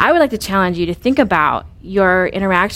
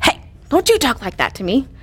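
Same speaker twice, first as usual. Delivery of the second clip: a touch of a harsh scold, slightly higher; scolding parent; 4 semitones higher than usual. Retold or imitated. Mother to disrespectful teenage daughter, mild scolding. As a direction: mildly scold a disrespectful teen. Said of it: "my normal response would be like".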